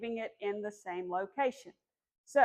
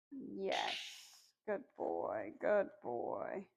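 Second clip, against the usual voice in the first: soft praise; 2 semitones lower, 8 dB quieter, much slower than usual. Real, not acted. Praising puppy after recall.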